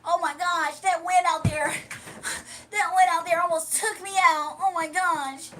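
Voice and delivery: hammed-up windblown whine; mock Dimitri complaining the wind nearly took him; lightly voiced Dimitri. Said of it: "scrawny ass gonna fly away".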